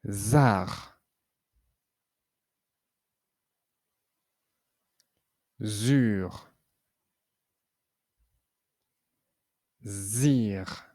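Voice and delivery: each syllable sounded out; teacher reading syllables aloud; syllable drill. Shown as a demonstration. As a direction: model consonant-vowel-consonant syllables. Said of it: "Allez, c'est parti".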